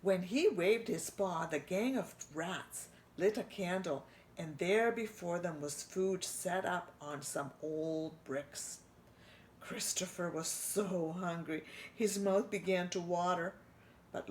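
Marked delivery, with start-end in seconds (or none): none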